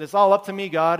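Elijah crying out loud in despair; loud cry to God. Retold or imitated. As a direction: call it out loud, burdened.

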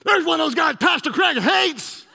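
put-on high, loud terrified little boy; imitating a panicked kid; mock terror. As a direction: shout to Dad in hammed-up alarm. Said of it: "with terror".

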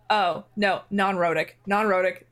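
normal talking voice

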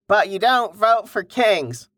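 put-on high-pitched comic Monty Python accent. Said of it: "What was that accent?".

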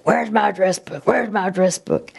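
lightly voiced dad searching for his book; dad asking. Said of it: "but he would go".

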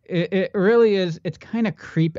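words pushed through clenched teeth; talking through teeth. Real, not acted. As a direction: speak through your teeth. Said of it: "I'm talking through my teeth right now".

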